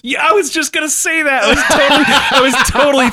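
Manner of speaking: laughing. Real, not acted. Laughing excitedly at shared guess.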